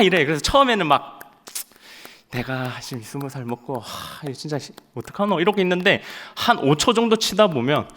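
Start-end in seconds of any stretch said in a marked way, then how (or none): 2.3-5.4 much softer, grumbling to himself; a reluctant young soldier muttering; embarrassed mutter. Retold or imitated. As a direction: mumble in disbelief.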